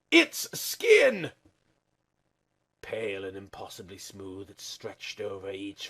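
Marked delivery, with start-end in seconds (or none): 0.1-1.3 high, loud cry of horror; horrified shriek. A narrator's reading. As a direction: shriek it in disgust and fear. Horror bursts, repeated shriek.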